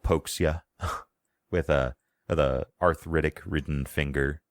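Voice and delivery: ordinary talk, nothing marked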